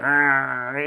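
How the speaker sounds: put-on soft grumbling old-man growl; low-key mock grouchy groan; grumpy growl. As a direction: imitate a grouchy old man's wordless grumble. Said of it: "this grumpy old dude".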